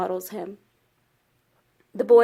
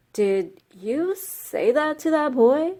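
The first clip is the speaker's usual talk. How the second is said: slow, high-pitched challenge; storybook rabbit interrogating slowly; incredulous questioning; 6 semitones higher, much slower than usual. Acted. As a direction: draw it out high, as if astonished.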